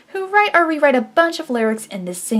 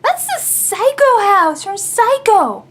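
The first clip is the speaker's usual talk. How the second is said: amazed; 6 semitones higher than usual. Real, not acted. Shocked recognition of reference.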